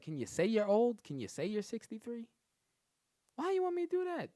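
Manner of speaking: high, loud pleading voice; mock pleading. Begging the older coworker to admit her age, squeaky. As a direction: beg in a hammed-up squeal.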